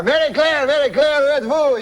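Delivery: high, excited French-Canadian calling; Jean-Luc. Acted. Uncle runs in looking for his wife, excited French-Canadian accent. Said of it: "my Uncle Jean-Luc".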